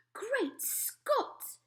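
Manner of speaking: queen stunned by the headline; cried out. Acted. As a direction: gasp it out in shock. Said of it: "cried the famous voice".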